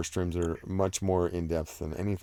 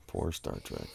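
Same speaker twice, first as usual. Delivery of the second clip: "Poor Star Trek" said much lower and softer, like a mourner; mock mourning; 11 semitones lower, 11 dB quieter than usual. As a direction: sigh "Poor Star Trek" in a low, hushed mock lament. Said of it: "May you rest in peace".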